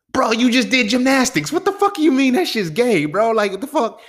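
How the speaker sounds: high, loud disbelieving outburst; incredulous outburst. Real, not acted. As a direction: throw it back at him, stunned and high. Outraged reaction to friend's claim.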